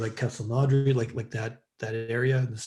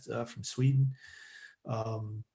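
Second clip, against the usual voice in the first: ordinary delivery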